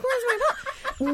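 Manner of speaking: very high, squeaky plaintive wail; mock wailing demand. Wife demanding husband's apology, mock high wail. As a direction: squeal it like a hammed-up wronged wife. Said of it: "It's like".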